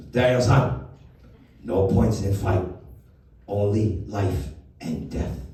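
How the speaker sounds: put-on slow, clipped Japanese-accented English; Mister Miyagi. Old sensei gives Daniel a pep talk before the fight, in a Japanese accent. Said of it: "He does in that classic Miyagi accent".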